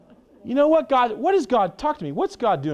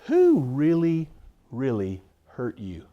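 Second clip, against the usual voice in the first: slow, gentle and searching; tender question; 4 semitones lower, slower than usual. Retold or imitated. Counsellor asking the hurting woman, slow and tender.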